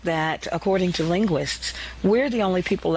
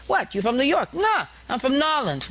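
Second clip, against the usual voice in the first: put-on higher-pitched New York-style New Orleans accent; 7 semitones higher than usual. Confused outsider questioning a New Orleans local, in New York-like accents. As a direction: play a New Orleans local mistaken for a New Yorker. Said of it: "more like New Yorkers".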